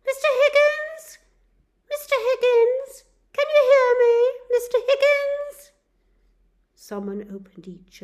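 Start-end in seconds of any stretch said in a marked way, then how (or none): 0.0-5.7 much higher, much louder repeated calling; nurse calling out. Acted. Nurse calls the waking patient's name, loud and insistent. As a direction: call him back to consciousness.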